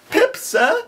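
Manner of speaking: put-on high, small child's voice; frightened child. A narrator's reading. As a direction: answer tiny and scared.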